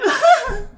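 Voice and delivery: short high burst of laughter; quick squeaky laugh. Real, not acted. Laughs at own intro.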